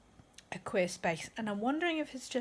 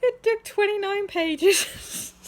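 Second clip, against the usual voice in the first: high, loud, slow words through real laughter; cackling; 10 semitones higher, 11 dB louder, much slower than usual. Real, not acted. Reader finally getting the joke in the book, cackling. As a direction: squeal it out while cackling. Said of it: "Sorry, I'm cackling".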